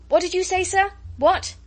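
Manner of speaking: high, loud shouted question. A narrator's reading. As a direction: call out to someone unheard in a gale. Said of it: "converse with raised voices as people quarrel".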